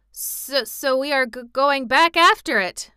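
high, stammering, halting excuse; trembling with fear. A narrator's reading. Fleeing general explaining himself, nervous stammer. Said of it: "trembling with fear".

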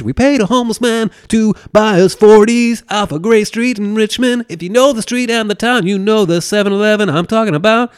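loud, high-energy recollection; animated boasting. Real, not acted. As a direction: crank it up, proudly calling out the details. Animated insider brag about drinking.

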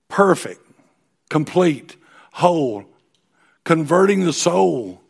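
loud, ringing pulpit voice; booming sermon delivery; forceful preaching. Acted. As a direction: proclaim the verse to the room. Preaching scripture at full force.

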